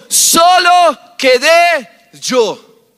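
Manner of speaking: slow, drawn-out loud cry; Elijah's cry. Acted. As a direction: hold each word and call it out over the crowd. Elías clama en el relato. (grito lento y prolongado de Elías)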